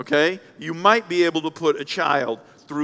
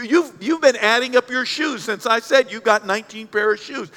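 ordinary talk

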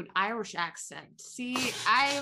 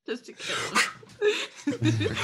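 laughing; 12 semitones higher, much slower than usual. Real, not acted. Laughing at own joke.

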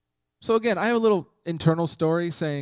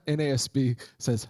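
normal talking voice

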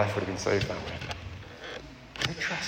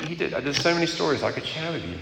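ordinary talk, nothing marked

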